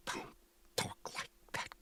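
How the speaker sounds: hushed horrified rebuke; mother hushes her son's morbid joke; shocked whisper. A narrator's reading. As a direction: whisper it as if the words are bad luck. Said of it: "she cries".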